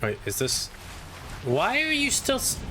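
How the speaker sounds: ordinary delivery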